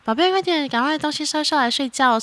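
put-on high-pitched hurry-up to a child; imitated bedtime nagging; urging mother. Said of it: "然后就真的是从".

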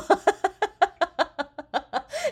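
high loud burst of laughter. Real, not acted. Laughing hard about her mother's reasoning. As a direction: crack up at the memory.

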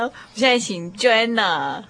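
ordinary talk, nothing marked

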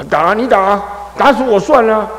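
high, loud mock defiance; defiant child. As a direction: dare them to hit you. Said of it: "你知道他不会跟你僵".

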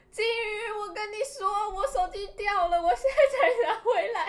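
high, loud excited call, half laughing; imitating an excited friend who got the phone back. Friend calling to announce the found phone, high excited.

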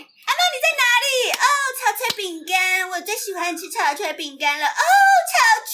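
high squealing cartoon voice, rising to a shout; hammed-up dub princess, laughing through it; Princess Idiot voice. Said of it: "我以前超爱学白痴公主的".

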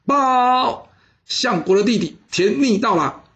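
very high, ringing announcement; herald's call. Acted. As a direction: shout the report into the tent. Messenger announces arrival. (高声通报)

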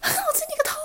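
angry teacher. Retold or imitated. Teacher scolding the comic-reading student, shrill shout. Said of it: "然后老师有说什么吗".

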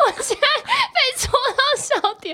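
high, laughing to tears; can't stop laughing, teary. Real, not acted. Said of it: "我笑到流泪了". (笑到流泪地说)

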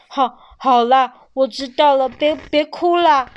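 loud, stammering consoling; embarrassed father tells baby not to cry; gruff comfort. A narrator's reading. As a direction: awkwardly soothe a crying child. Father comforting crying child.

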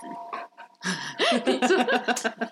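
laughing hard at a joke; drawn-out amused laugh; long high laugh. Real, not acted.

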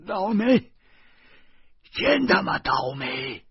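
loud, high exasperated cursing; crying out. A narrator's reading. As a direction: shout your rotten luck. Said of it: "小贩儿呼喊道".

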